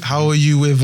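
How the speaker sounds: ordinary talk, nothing marked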